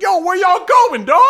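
loud, high-pitched challenge; calling out. Retold or imitated. Dude in the crowd stops passers-by, calling out. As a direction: imitate a dude shouting over at you. Crowd front man calls out.

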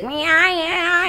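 put-on baby voice. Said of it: "he's like".

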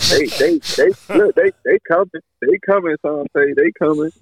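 put-on high, pleading old grandma. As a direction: reassure him in a high granny voice. Teller voices grandma reassuring Sante.

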